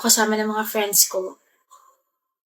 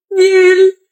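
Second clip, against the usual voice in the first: loud, high call of Viel; bright shout of her name; 7 semitones higher, 6 dB louder than usual. Acted. Calling partner's name.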